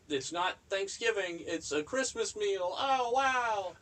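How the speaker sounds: high, hammed-up excited announcer; mock hype host. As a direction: oversell it like a cheesy host. Mock excited video narration.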